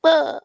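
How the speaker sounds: high mocking "ah"; short, high-pitched mock cry; little punk's jeer. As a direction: imitate a punk kid's taunting noise. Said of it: "like, a little punk".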